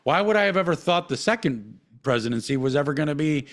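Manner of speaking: usual speaking voice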